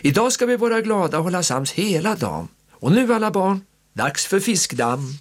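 high, lively aunt voice; rally the children; cheerful aunt. A narrator's reading. Aunt cheering the children on, high and lively.

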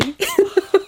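high-pitched laugh; high, far laugh. Real, not acted. Laughing at sisters' warning.

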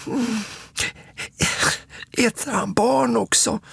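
put-on high, hushed little child voice; gasping child. A narrator's reading. Småtting asking whether the monster eats children, gasping high. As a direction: gasp it out in a small, high voice. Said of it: "flämtar Småtting".

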